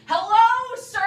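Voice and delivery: loud overdone greeting to a stranger; loud slow hello. Retold or imitated. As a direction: talk loud as if to a foreigner. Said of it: "you talk loud when you just".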